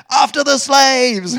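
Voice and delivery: high, loud, drawn-out charge cry; rally the troops to give chase, hammed up; mock battle cry. Egyptians' battle cry, acted.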